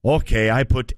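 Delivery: lightly put-on Chinese accent, low; sheepish confession in a Chinese accent. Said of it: "It's an accent".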